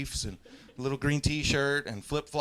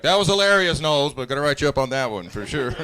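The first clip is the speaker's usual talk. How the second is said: amused superior; 6 dB louder than usual. Retold or imitated. Acted superiors praising then disciplining.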